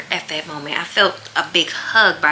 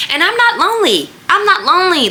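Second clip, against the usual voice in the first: much higher, louder insistence on not; excited realization; 11 semitones higher, 5 dB louder, faster than usual. Retold or imitated. Telling herself alone at home she's not lonely, thrilled. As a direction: exclaim it with delighted surprise.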